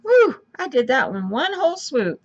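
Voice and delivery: loud, high whoop of delight; excited cheer. Real, not acted. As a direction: cheer it out, thrilled. Cheers after a smooth squeeze.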